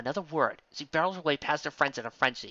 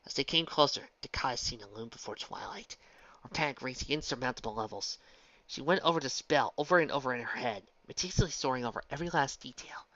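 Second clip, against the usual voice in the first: plain reading voice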